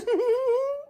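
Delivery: high little rascal giggle; the rascal giggles after answering the captain. Acted. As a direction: giggle brightly at your own answer. Rascal laughs.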